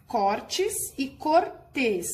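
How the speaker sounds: Cortes with open stressed ó, then cortês with closed ê stressed at the end, slowly; teacher slowly reads the word pair aloud to the class; slow contrast reading. Shown as a demonstration. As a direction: stretch out Cortes e cortês so the accent difference is clear. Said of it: "Lê em voz alta as palavras a seguir".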